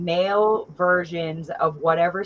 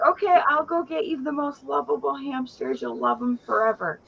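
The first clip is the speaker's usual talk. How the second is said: mock promise; 7 semitones higher than usual. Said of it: "I was like".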